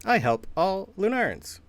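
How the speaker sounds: programmed helper bot on a loop; stiff robot. Acted. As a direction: repeat it like a canned robot line. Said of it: "Just over and over".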